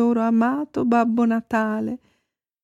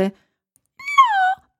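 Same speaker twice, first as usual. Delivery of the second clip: very high squealed answer; dolphin character. A narrator's reading. Dolphin answering the reindeer, squeaky.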